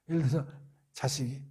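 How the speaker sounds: usual speaking voice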